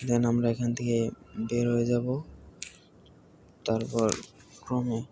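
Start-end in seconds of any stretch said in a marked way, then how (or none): none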